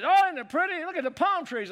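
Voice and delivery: high delighted gushing over the view; mock-swooning wife admiring palm trees. Husband imitating his wife gushing at the palm trees, high and delighted. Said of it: "And she said".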